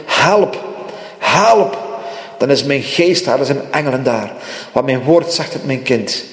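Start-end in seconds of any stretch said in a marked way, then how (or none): 0.1-0.6 a rising plea for help; first plea for help; pleading cry. Pretend. 1.2-1.8 second call, more urgent; desperate cry. Pretend. Second, stronger cry for help.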